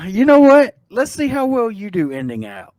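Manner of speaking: high, loud teasing dare; playful challenge. Real, not acted. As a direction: toss him the challenge, teasing. Teasing challenge to end show.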